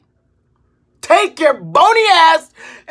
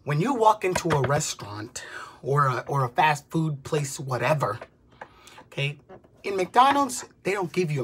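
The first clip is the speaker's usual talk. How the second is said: whisper; 10 semitones lower, 9 dB quieter than usual. Real, not acted. Sudden hushed aside in the rant.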